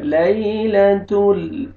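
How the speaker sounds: لیلۃ with a gentle ya, drawn slowly; soft slow recitation. Shown as a demonstration. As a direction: ease gently through لیلۃ ال. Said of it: "نرمی سے ادا کرنا ہے".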